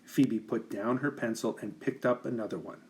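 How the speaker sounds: usual speaking voice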